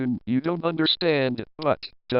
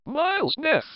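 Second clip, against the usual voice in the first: hurried correction; 11 semitones higher, faster than usual. Acted. Urgently correcting before the boss arrives.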